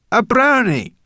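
high, loud, impatient repeat. Acted. Brownie impatiently repeating herself to the boy. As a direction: repeat it as to a slow child. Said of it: "repeated Sorrel impatiently".

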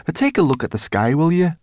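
loud, gruff high brownie; growling brownie. Acted. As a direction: snap it at them impatiently. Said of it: "growled Sorrel".

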